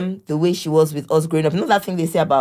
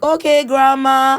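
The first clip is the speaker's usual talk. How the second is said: higher, louder, slow dismissive drawl; imitating her sons; 9 semitones higher, 7 dB louder, slower than usual. Mother mimics her boys brushing off grandma, high, loud and slow. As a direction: brush her off slowly and breezily. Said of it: "now they're like".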